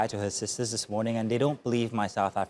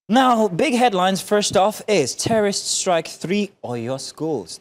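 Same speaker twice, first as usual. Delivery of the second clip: headline read; 8 semitones higher, 6 dB louder, slower than usual. Acted.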